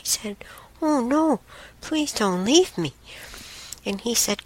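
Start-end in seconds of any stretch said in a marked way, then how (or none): 0.8-2.9 high-pitched, mildly pleading retelling; imitating an upset plea; retold upset plea. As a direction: plead not to be left, retold rather than lived. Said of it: "And I got upset".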